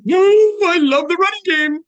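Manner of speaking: high, loud emphatic protest. Real, not acted. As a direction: burst out insisting you love it. Emphatic reply to running game.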